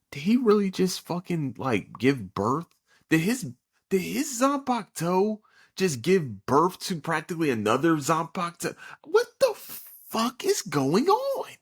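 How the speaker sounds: high, rising, baffled outburst; can't believe what you're seeing; incredulous disbelief. Retold or imitated. Rewatching the episode, high disbelieving outcry. Viewer reacting to bizarre scene.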